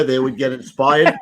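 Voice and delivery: ordinary talk, nothing marked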